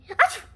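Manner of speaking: sudden loud high achoo; sneeze. Acted. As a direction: burst out sneezing. Gerald sneezes again.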